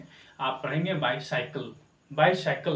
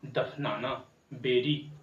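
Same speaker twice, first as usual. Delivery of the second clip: usual speaking voice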